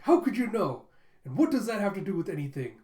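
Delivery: high, loud mock outburst; hammed-up flustered protest; indignant shock. Boy whose bad test score got exposed, indignantly shocked.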